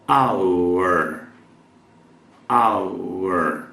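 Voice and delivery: our said fully as one syllable; "our" pronounced in full. Shown as a demonstration. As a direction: say "our" like the word hour. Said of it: "Aqui você pode dizer, como acabei de pronunciar".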